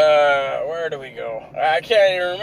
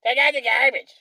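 mocking boss; 10 semitones higher than usual. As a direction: parrot her order back in a high mocking voice. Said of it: "I was like".

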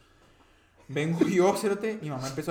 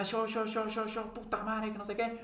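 irritado; 7 semitones higher than usual. Retold or imitated. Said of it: "yo no estaba craneándola".

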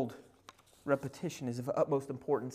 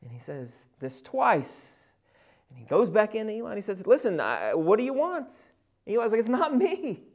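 loud preaching at full force, higher; 6 semitones higher, 9 dB louder than usual. Acted. Preacher telling the congregation about Samuel, preaching loud. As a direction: preach it out with rising fire. Sermon retelling Samuel story.

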